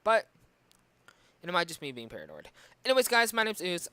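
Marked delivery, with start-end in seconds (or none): 2.8-3.9 high, loud outro greeting; energetic sign-off. Real, not acted.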